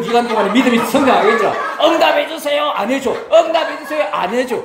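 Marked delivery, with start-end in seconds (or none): 1.8-2.6 pleading prayer. Retold or imitated. 기도하는 사람 흉내. 3.3-4.0 pleading prayer. Retold or imitated. 기도하는 사람 흉내.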